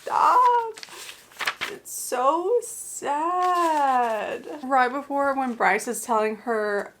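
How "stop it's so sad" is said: high, strained cry of Stop then a long drawn-out so sad; choked-up wail about a sad scene; real grief. Real, not acted. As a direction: near tears, stretching it out. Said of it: "I could cry right now".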